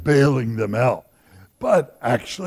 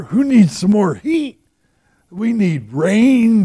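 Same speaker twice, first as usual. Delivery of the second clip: louder, rising plea for rain; emphatic Elijah; 6 semitones higher, 5 dB louder than usual. Acted. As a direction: press it: we need rain, brother.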